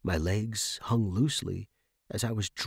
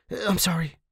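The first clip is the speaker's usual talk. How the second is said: ordinary delivery, nothing marked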